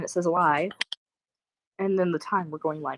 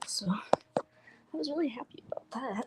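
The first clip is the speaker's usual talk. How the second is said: whisper; 3 semitones higher, 7 dB quieter, slower than usual. Real, not acted. Whispered aside about cousin's bed.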